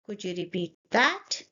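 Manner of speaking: sharp upward lift on "that"; rising question tune. Shown as a demonstration. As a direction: let "that" climb as a question. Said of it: "my voice rises at the end".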